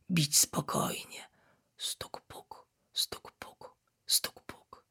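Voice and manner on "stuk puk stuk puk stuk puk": soft heartbeat. A narrator's reading. Heartbeat slowing as cub sleeps.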